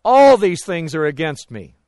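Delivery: loud imitated groaning outcry; Jacob. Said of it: "I would hear his groan".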